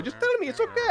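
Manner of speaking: high-pitched coaxing dad, mock Lebanese accent; pleading high. Dad pleads with son to confess, high mock accent. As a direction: squeak it out like a doting father. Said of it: "He goes".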